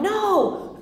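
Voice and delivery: high, hammed-up "no"; laughing, high-pitched denial; mock refusal. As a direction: answer high with a laugh behind it. Voices answer to question, laughing.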